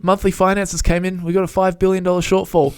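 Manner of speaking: high, loud comic scramble; hammed-up urgency, grasping for a culprit; mock panicked official. Podcaster plays regulator scrambling to fill a shortfall, mock panic.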